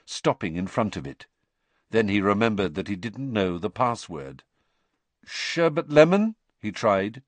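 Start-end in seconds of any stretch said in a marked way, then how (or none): 5.3-6.4 tentative. A narrator's reading.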